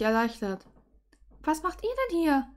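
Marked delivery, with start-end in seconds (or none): 1.4-2.5 high-pitched surprised question; light, high voice of startled wonder; astonished. A narrator's reading. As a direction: ask it in amazement, voice lifted. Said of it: "Verwundert sah ich sie an".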